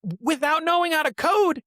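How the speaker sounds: bursting with amazement over the brother's app; thrilled and amazed; excited. Real, not acted. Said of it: "that excitement you have".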